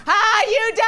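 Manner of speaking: high, drawn-out mock cry for help; imitated drowning cry. Drowning son calling to his mother, hammed-up high yell. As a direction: wail it out like a drowning child.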